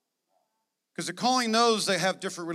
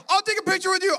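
much higher, louder fan squeal; starstruck fan; 12 semitones higher, 7 dB louder, faster than usual. Acted. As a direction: gush like meeting a celebrity. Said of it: "Some of you are like".

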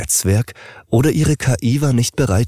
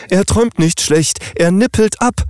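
much higher and louder, in panic; alarmed mattress; 13 semitones higher, 3 dB louder than usual. Acted. Mattress cries alarm top priority.

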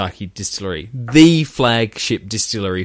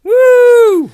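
high loud whoop; woo cheer; 9 dB louder than usual. Pretend. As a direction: whoop with joy. Mock celebration cheer.

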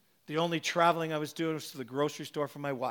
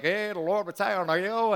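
slow, light imitation of an Irish accent; slow mock Irish accent, only lightly put on. Imitating the Irish prophet laying hands on him, slow Irish accent. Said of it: "he started speaking Irish".

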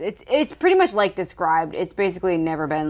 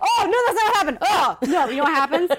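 mock teasing voice; 9 semitones higher, faster than usual. Teasing voice of Tony.

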